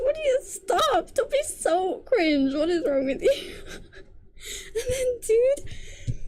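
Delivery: high, squealing disbelief, half laughing; cringing laugh-cry. Real, not acted. Reviewer squealing in disbelief at a cringe line. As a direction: wail at the cringe. Outraged by the lion's den line.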